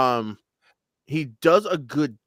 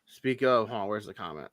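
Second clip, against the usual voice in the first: ordinary talk, nothing marked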